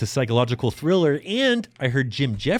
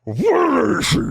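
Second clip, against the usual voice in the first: loud, high dramatic cry; Kevin Costner impression; 13 semitones higher, 5 dB louder, slower than usual. Shown as a demonstration. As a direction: belt it like a movie hero. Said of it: "y'all just sound like Kevin Costner".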